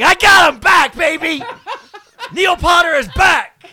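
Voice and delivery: high, loud victory yell, slow at the end; triumphant shout. Retold or imitated. Customer leaving Chipotle with stolen napkins, triumphant yell. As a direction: crow it like you won. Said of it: "and I'm like".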